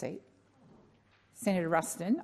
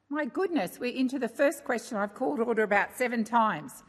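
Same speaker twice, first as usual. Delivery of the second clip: ordinary talk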